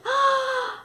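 sharp high blowing gasp; a big huffing breath. Shown as a demonstration. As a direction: blow out hard like the pig.

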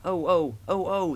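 a light mock cry of bumped trucks. A narrator's reading. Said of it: "they cried again".

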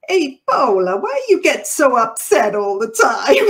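big, loud, high-pitched Italian impression; put-on Italian accent. Imitating an Italian boss asking why she worries, hammed-up Italian accent. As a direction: ham up a fiery Italian accent, breaking into laughter. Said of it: "in my head sounds like his accent".

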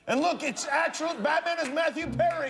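high, projected show-host voice; talk show host announcement. Real, not acted. Host plugging the guest's premiere to the audience, high announcer delivery.

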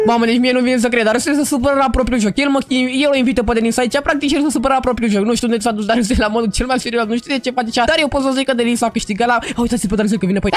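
low, dry voiceover telling viewers what happened; deep commentator voice. Acted. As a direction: drop into a deep narrator voice and tattle on Darusel.

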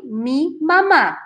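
slow "mi mamá", stressed on the final "má"; acento final en mamá. Shown as a demonstration. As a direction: say "mi mamá" slowly, leaning on the last syllable. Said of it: "Tienen que decir". ("mi mamá" despacio, con la última sílaba más fuerte)

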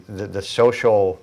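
normal talking voice

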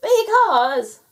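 high, loud gnome yell. A narrator's reading. Gnome answering the otter, yelling. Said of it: "yelled the gnome".

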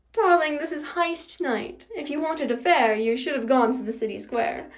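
theatrical villainess, mock-sweet; condescending villainess. A narrator's reading. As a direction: talk down to your rival. Nemesis condescends to Percy.

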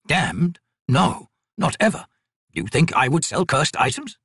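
put-on slow, shocked old man's protest; alarmed denial. Trader denies selling cursed goods, alarmed. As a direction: recoil at the very suggestion.

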